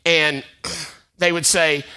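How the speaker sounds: ordinary delivery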